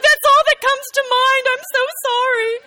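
very high, loud, flustered mock mother's apology; nervous apology. Mother apologizes to daughter mid-procedure, frantic and high. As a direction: squeak it out like a panicked mom. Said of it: "She was so nervous".

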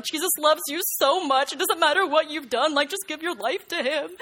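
high, loud, eager preaching to the nurses; bubbling over with zeal; eager witnessing. Retold or imitated. Teen witnessing to nurses, eager and loud.

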